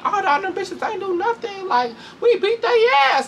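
high, gloating put-on voice ending in a laugh; mocking the girls. Mimicking the fighters bragging after, high and gloating. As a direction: brag like a smug mean girl.

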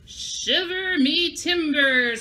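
slow, drawn-out pirate exclamation with a laugh; scared pirate cry. Shown as a demonstration. Teacher showing kids what pirates say when scared, slow and hammed-up. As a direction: stretch it out like a spooked old sailor. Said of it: "you are gonna say".

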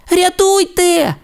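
very high, slow help call; cry for help. A narrator's reading. Timmi stuck in the swamp squeals slowly for help. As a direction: squeal for rescue. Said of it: "кричав Тімі".

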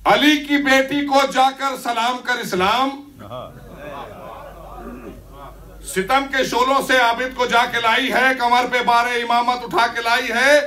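declaimed verse, building to a high pitch, then falling at the close; poem recitation. Acted. Poet delivering the full quatrain to the gathering, rousing declaimed recitation. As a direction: drive the couplet towards its final line.

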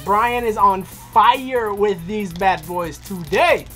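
normal talking voice

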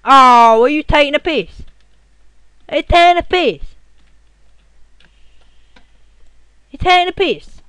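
raging gamer yelling at a glitch; furious shout. Real, not acted. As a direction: scream at the screen in disbelief.

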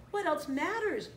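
high, animated exclamation; exclaiming. A narrator's reading. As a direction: brush the question aside with excitement. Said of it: "Nala exclaimed".

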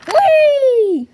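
loud high play whoop; gleeful loud "whee". Pretend. Toy character leaping to escape, gleeful whoop.

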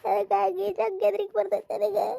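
slow, high-pitched wailing; crying. Acted. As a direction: sob out your fear, drawing the words out. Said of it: "ಕಣ್ಣ್ ಒರ್ಸ್ಕೊಂತ ಇಲ್ವಾ ಗಿರ್ಜವ್ವ ನೀನು". (ಬಿಕ್ಕಿ ಅಳುತ್ತಾ ಹೇಳುವುದು)

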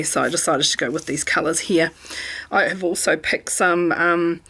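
normal talking voice

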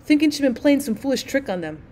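plain reading voice

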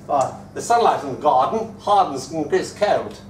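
mock English-accented poetry reading; put-on English accent, declaiming verse. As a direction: imitate a poet reciting in an English accent. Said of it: "he reads in an English accent".

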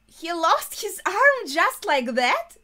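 loud, high, drawn-out astonished question; incredulous, swooping high and loud; stunned disbelief. Real, not acted. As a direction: exclaim it slowly in real shock. Stunned by character losing arm.

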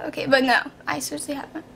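usual speaking voice

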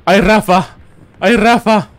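high, loud repeated cry; excited ay. Acted. Streamer cheering on Rafa, loud excited cries.

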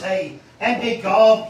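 usual speaking voice

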